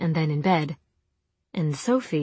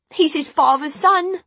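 tearful; 10 semitones higher, 6 dB louder than usual. Acted. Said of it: "then the tears did fall".

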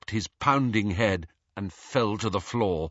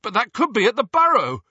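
loud, high and fast alarm; 13 semitones higher, 6 dB louder, faster than usual. A narrator's reading. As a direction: blurt it out in alarm. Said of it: "said Ron loudly".

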